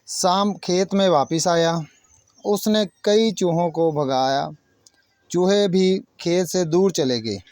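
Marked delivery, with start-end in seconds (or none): none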